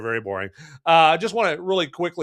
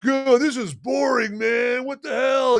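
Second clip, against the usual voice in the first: higher, mock-annoyed outburst; bored viewer complaining; 9 semitones higher than usual. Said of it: "and you're like".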